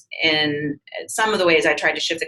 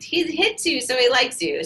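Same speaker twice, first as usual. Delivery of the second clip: pitched-up, sing-song parroting of a parent; a mother excusing a boy's hitting, mock higher voice; mock mom voice; 7 semitones higher than usual. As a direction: imitate a mother's tired cliché, higher. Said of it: "the mom saying".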